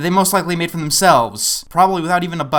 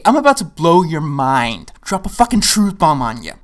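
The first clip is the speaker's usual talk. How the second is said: ordinary delivery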